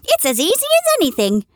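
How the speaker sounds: high, bouncy boastful voice; furry rabbit boasting. A narrator's reading. Furry rabbit brags to the toy rabbit, high and boastful. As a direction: show off to the new rabbit. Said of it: "said the furry rabbit".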